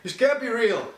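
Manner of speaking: mock hero denial. Mimics hero's denial.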